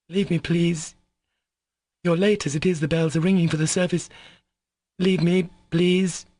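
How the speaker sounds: a proud woman sending Alyosha away; Katya dismissing. A narrator's reading. Katya's lines in the novel.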